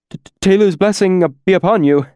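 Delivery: high, fast, tripping stammer; explosive stammer. A narrator's reading. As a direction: blurt it out, stuttering in panic. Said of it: "stammered explosively".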